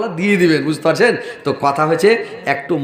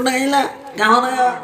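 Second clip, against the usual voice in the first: mock hoarse woman; 6 semitones higher, slower than usual. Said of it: "এরকম করবে".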